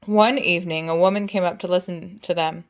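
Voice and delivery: ordinary delivery, nothing marked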